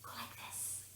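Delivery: soft breathy whispering like rustling leaves. Shown as a demonstration. Presenter showing a quiet rustling-leaves sound, whispered. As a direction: hush it like wind through leaves. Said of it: "the sound of gently rustling leaves".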